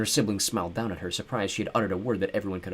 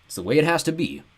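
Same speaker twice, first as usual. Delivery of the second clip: smoothly; 6 semitones higher, 4 dB louder than usual. A narrator's reading. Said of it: "Bruce said smoothly".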